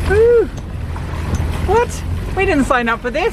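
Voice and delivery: high-pitched mock outrage; squealed, incredulous objection; playful protest. Real, not acted. Playful protest at having to steer.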